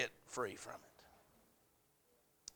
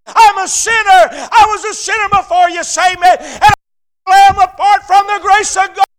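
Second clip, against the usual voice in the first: high, shouted confession to God; impassioned preaching; 14 semitones higher, 25 dB louder than usual. Acted. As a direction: cry out at full force like a revival preacher. Preaches at full force.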